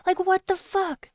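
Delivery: high, slow exasperated swear; outraged question; fed up; heated. Real, not acted. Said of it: "getting so heated".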